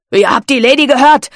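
loud, barked captain's command; barked order. A narrator's reading. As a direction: snap it at the crew. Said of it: "blaffte er seine Männer an".

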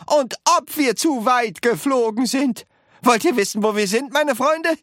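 high, excited shouted retort; roaring. A narrator's reading. Wonka telling his friends how far they flew, roaring. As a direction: shout it like a triumphant showman. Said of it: "brüllte Mister Wonka".

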